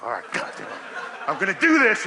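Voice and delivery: loud, high self-pump-up through a laugh; mock fired-up yell. Lifter pumping himself up to eat the pizza, mock fired-up yell. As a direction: psych yourself up, half laughing.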